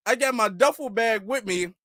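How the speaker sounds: usual speaking voice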